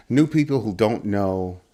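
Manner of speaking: ordinary talk, nothing marked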